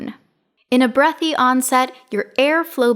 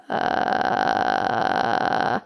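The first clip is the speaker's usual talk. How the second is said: low creaky fry, very low, softer; vocal fry; 13 semitones lower, 5 dB quieter than usual. Shown as a demonstration. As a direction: creak on the lowest pitch. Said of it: "low, creaky sound ... like this".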